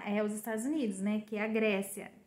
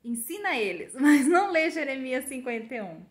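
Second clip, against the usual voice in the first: laughing; 2 semitones higher, 7 dB louder than usual. Retold or imitated. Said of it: "E falou assim".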